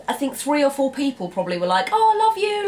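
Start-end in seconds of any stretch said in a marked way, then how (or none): 1.9-2.7 very high, louder, sing-out sign-off; imitated gushing affection. Signing off a conversation with friends, mock-gushing and affectionate. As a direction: gush it high, like a fond goodbye.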